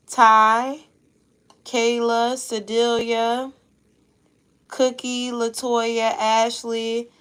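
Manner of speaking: loud, slow roll call of names; announcing names. Real, not acted. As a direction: read each name out clearly to the room.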